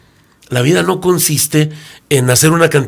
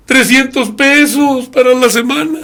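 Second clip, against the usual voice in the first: much higher, pleading woman's voice; pleading humble woman; 9 semitones higher, 3 dB louder than usual. Acted. A humble woman pleading her troubles to the businessman, high and plaintive. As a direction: beg like a poor humble woman.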